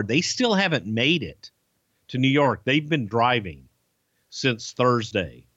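ordinary delivery

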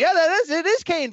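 eager interruption, voice up; excited cut-in. Real, not acted. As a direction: jump in excitedly over them.

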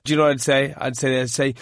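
usual speaking voice

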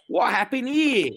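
lightly voiced Australian with a Southern drawl; a hint of faux Australian with a Southern drawl. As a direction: say it like a kid's fake Australian cop, drawled. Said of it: "with Australian accent, but with a Southern drawl".

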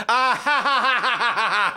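mock evil cackle ha ha ha; hammed-up wicked witch laugh; cartoon villain laugh. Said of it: "He said, actually said".

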